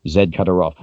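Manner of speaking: ordinary delivery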